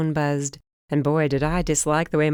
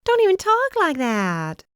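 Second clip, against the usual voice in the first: much higher, louder, slow scold; Holly begging her not to say it, higher; alarmed scolding; 13 semitones higher, 4 dB louder than usual. A narrator's reading. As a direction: hold the shock into a scold. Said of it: "Holly scolds after shock".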